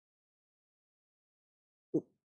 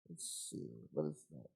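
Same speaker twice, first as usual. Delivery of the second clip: breathy unvoiced whisper, much softer; whispered to self; 4 semitones higher, 14 dB quieter than usual. Real, not acted. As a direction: whisper it to yourself while rummaging. Muttering to self while searching.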